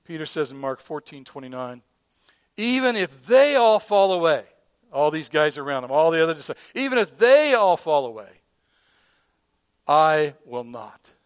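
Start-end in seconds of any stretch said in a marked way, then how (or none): 2.6-4.4 loud, high-pitched boast; boastful. Retold or imitated. Peter boasting to Jesus of his loyalty, boastful. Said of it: "who boasted that you would never deny". 6.8-11.1 loud boast slowing to an emphatic vow; boastful. Retold or imitated. Peter vowing to Jesus he will not fall away, boastful. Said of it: "who boasted that you would never deny".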